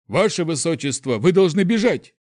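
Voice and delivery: high, loud urgent cry; urgent warning. Acted. Guard urging his prince to flee, urgent shout. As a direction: shout it to get him moving.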